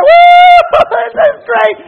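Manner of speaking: very high, loud whoop and laugh; whooping joy. Acted. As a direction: cheer wildly. Couple celebrating in story.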